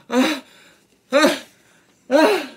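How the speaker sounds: loud, high rising "ah"s before a sneeze; acted pre-sneeze gasps, spaced out; sneeze build-up. A narrator's reading. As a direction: gasp towards a huge sneeze.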